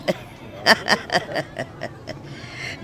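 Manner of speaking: breathy gloating villain laugh; airy evil chuckle. Acted. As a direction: laugh softly at the rival's doom. Villain gloats over her plan.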